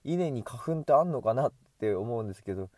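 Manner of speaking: ordinary talk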